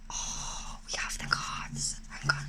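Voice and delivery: hushed, unvoiced whisper of awe. Real, not acted. Card reader showing viewers the new deck, whispering. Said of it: "I don't know why I'm whispering".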